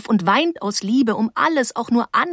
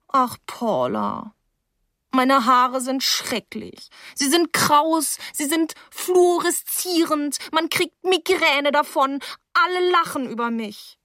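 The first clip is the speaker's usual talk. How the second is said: put-on higher, slow whining child; drawn-out childish lament, higher; whiny girl; 7 semitones higher, slower than usual. Girl lamenting her hair to Paula, higher slow whine.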